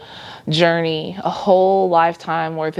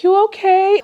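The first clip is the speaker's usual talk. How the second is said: put-on high, louder childlike "you okay?"; nephew asking; 4 dB louder than usual. Nephew checks on his aunt, in a high childlike voice. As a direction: voice the little nephew checking on her, high and bright. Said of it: "he was like".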